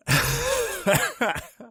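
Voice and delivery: high-pitched burst of laughter. Real, not acted. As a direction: laugh out loud at the joke. Laughs at own joke.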